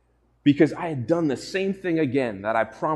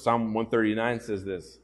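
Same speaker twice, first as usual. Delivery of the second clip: usual speaking voice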